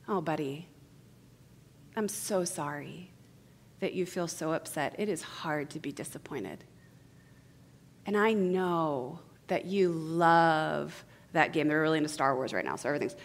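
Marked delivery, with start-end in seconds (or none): 0.1-11.7 put-on tenderly contain an upset child; soothing parent. Acts mother soothing upset son.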